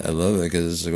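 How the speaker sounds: normal talking voice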